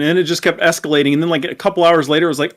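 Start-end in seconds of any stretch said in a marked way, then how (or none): none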